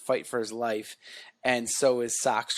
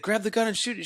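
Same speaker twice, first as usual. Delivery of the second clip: urgent soldier; 10 semitones higher, faster than usual. Retold or imitated. Soldier urging cadet in battle.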